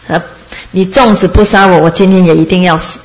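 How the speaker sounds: usual speaking voice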